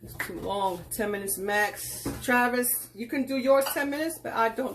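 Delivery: ordinary talk, nothing marked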